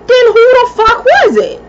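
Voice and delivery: high, loud, outraged question; indignant outburst. Retold or imitated. Telling the coworker at the store, a loud outraged demand. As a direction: throw it out in disbelief, loud and high.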